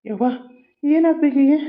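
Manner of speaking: high, panicked cries. Acted. Brother panics as his sister collapses, high and frightened. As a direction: gasp out what happened to her.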